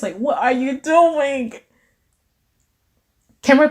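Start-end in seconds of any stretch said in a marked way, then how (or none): 0.2-1.5 slow, exaggerated "what are you doing"; emphasized voice. Shown as a demonstration. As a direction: lean hard on a drawn-out "doing". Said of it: "trying to emphasize this voice".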